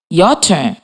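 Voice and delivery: ordinary talk, nothing marked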